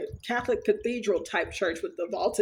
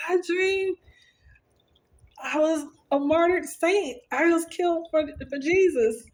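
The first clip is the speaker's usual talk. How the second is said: excited retelling; 8 semitones higher than usual. Retold or imitated. Wife telling her husband the dream, high and thrilled. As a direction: gush it out like big news. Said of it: "I was so excited".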